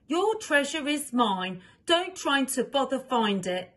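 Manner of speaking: taunting note. Acted. Thief's note read aloud.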